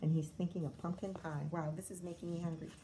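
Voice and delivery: plain reading voice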